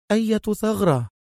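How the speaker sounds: high, puzzled woman's question; astonished. A narrator's reading. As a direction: ask high, in bewilderment. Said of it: "سألته في دهشة".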